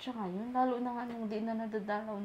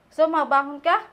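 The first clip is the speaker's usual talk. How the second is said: louder, rising coax; 10 dB louder than usual. Real, not acted. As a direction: urge her up out loud. Coaxing mother loudly.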